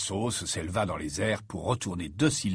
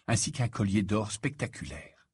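ordinary delivery, nothing marked

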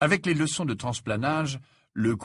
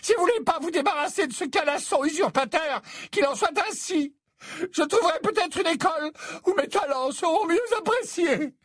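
very high, hurt and theatrical; deeply wounded; 3 dB louder than usual. A narrator's reading. Trelawney hurt by Dumbledore's dismissal, wounded high voice. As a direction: sound deeply offended. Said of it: "d'une voix profondément blessée".

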